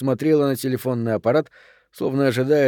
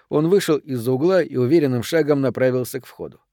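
ordinary delivery

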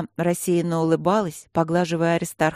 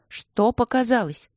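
high, slow, insistent question; persistent questioning; 6 semitones higher than usual. A narrator's reading. Student will not let the guardian off, persistent. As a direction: press him for the answer. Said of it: "не отступала Алинка с похвальной въедливостью".

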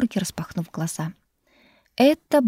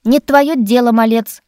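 loud dismissive snort; snapping retort; 4 semitones higher, 9 dB louder than usual. A narrator's reading. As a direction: brush him off sharply. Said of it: "фыркнула та".

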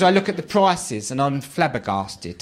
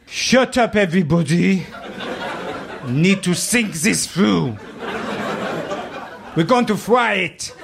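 mock pompous chef; 4 semitones higher, slower than usual.

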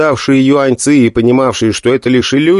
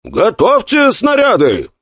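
battle command; 10 semitones higher, slower than usual. Acted. Battle command mid-assault.

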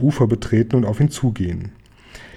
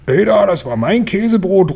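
higher, outraged call; indignant cry; 8 semitones higher, 3 dB louder than usual. Acted. Lion outraged that Oskar ate his cheese sandwich. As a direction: protest loudly at the stolen sandwich. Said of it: "ruft der Löwe empört".

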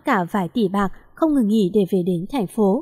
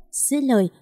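ordinary delivery

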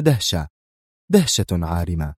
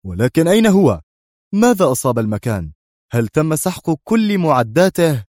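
agitated questions, pitched up; tense; 6 semitones higher, 4 dB louder than usual. A narrator's reading. Commander finds the hideout wrecked, tense and alarmed. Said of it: "في توتر قائلًا".

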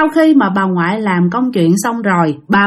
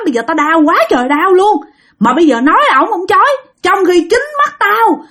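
high-pitched aggrieved mother telling on her husband; acted grandmother's heated grievance; indignant complaint; 8 semitones higher than usual. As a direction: complain to the children, outraged and pitched up. Grandmother angrily complains to her children.

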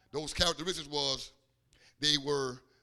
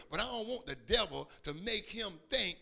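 usual speaking voice

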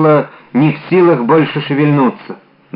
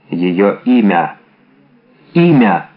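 slow, pressing question; insistent questioning; 6 semitones lower, slower than usual. A narrator's reading. D'Artagnan demanding her friend's name, insistent. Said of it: "допытывался Д'Артаньян".

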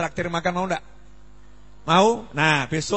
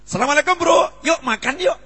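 bright, lifted greeting with "Yuk makan yuk" thrown in warmly; cheerful invitation; 9 semitones higher, 5 dB louder than usual. Shown as a demonstration. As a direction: greet a friend and pull them along to eat. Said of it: "Nah, keren ini". (salam ceria sambil mengajak makan)

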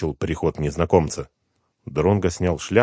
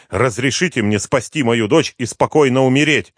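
lightly voiced desperate plea, raised; pleading dying father; 7 semitones higher, 7 dB louder than usual. A narrator's reading. Dying father begs the detective, raised and pleading. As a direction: beg him to let you save your daughter.

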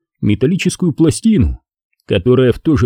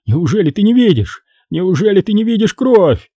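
high, pleading disbelief; a desperate plea rising to «кровь»; panicked; 8 semitones higher than usual. A narrator's reading. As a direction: beg him to see the blood. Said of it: "кричал Хеннесси в панике".

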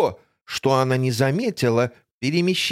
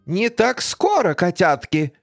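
high sneering villain voice; villainess voice; 4 semitones higher than usual. Acted. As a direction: gloat like a cartoon villainess. Villain Griselda appears. (злодейка с насмешкой)

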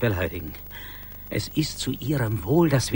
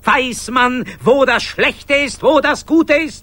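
much higher and louder, parroting with contempt; scornful mockery; 12 semitones higher, 11 dB louder than usual. Mocking his colleague's talk of evil, scornful. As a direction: throw his words back at him mockingly.

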